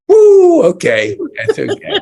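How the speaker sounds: high, loud whoop that settles into a laughing okay; surprised whoop. Retold or imitated. Host acting out her own reaction to missing things, a loud surprised whoop. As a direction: yelp in surprise, then laugh it off. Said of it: "it's like".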